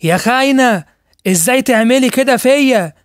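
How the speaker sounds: high, loud, enraged scream; husband screaming at the lying doctor; enraged shout. Acted. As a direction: scream the betrayal at her.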